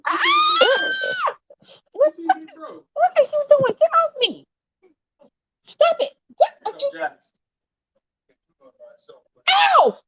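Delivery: high, strained cries of surprise; startled yelps. Real, not acted. Mom is jumped on by the dog, yelping high and startled. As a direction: fend off a jumping dog, yelping. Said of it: "I'm being accosted by a dog".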